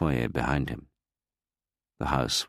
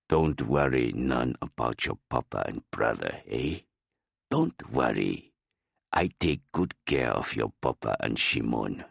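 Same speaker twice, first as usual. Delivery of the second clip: lower, lightly voiced simple-minded caretaker; old gardener reassuring Luke, lightly lower voice; 3 semitones lower than usual.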